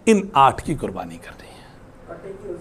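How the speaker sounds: slow, soft whispered aside. Real, not acted. Teacher murmuring an aside to the class, whispered. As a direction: drop to a whisper for a moment.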